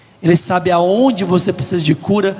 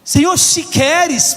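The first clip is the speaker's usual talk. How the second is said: crying out; 9 semitones higher, slower than usual. Retold or imitated. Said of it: "Aquele leproso gritou".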